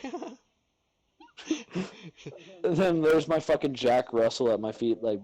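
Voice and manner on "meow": tiny, high soft meow; imitated meow. Cat looks up at angry owner, tiny high meow. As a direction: mew small and high like a cat.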